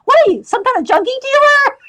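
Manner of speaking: hammed-up outraged punchline; punchline yell. Punchline of retold joke.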